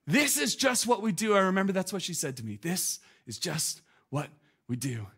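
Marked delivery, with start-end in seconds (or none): 2.6-5.1 softer, slow, hushed repeat; soft reflective echo. Retold or imitated. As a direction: let the words sink in quietly. Preacher repeats quote softly.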